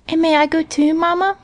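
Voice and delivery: put-on high little-girl voice; Minnie asking. A narrator's reading. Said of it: "asked Minnie".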